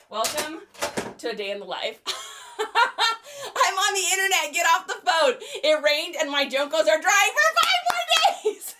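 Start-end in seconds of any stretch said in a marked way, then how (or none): 2.6-8.7 put-on high, loud teen shouting back; whiny teen yelling. Teen yelling at mom to get off the phone. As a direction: yell back like an annoyed teen.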